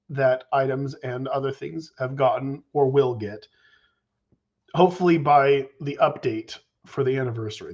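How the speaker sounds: ordinary delivery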